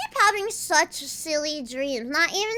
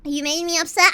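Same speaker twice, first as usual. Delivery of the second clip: normal talking voice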